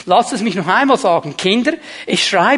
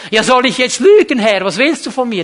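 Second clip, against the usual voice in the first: indignant protest; 4 semitones higher, 7 dB louder than usual. Acted. Acts a believer protesting to God.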